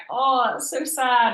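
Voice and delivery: high, drawn-out sympathetic "oh"; retold pity. Feeling for someone landing with nobody to greet them, drawn-out pity.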